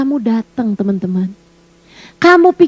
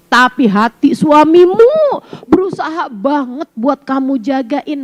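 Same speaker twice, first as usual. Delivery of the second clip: normal talking voice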